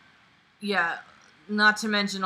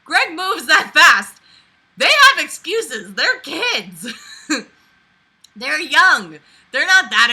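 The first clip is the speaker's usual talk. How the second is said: loud, slow, high outrage; exasperated rant; 10 semitones higher, 14 dB louder, slower than usual. Real, not acted. Reader ranting that Greg moves faster than kids, loud slow outrage. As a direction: hammer each word in disbelief.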